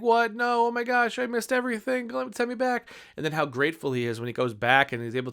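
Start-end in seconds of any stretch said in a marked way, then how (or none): 0.0-2.9 high-pitched mock panic, begging; a frantic man who just realized he missed his life; panicked man. Retelling Click's ending, high mock panic. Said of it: "He's like".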